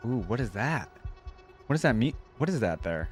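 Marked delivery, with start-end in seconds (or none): none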